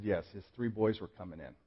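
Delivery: normal talking voice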